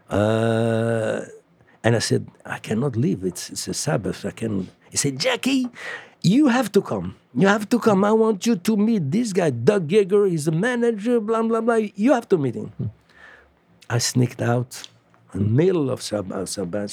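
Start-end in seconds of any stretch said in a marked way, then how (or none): 2.4-4.7 quiet refusal. Retold or imitated. Refusing, it's the Sabbath. 5.2-12.7 high, pushy, excited urging; eager Freddie insisting. Retold or imitated.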